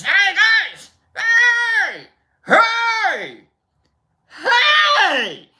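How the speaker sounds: high, loud falsetto shouts; yelling. Retold or imitated. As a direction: yell as if waking sleeping friends. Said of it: "like, I'm yelling".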